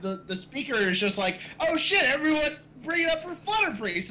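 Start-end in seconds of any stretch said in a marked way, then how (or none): 1.6-4.1 high, loud crowd-rousing call; rallying announcer. Retold or imitated. Panel host calling the crowd to cheer, excited shout. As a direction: hype up the whole room.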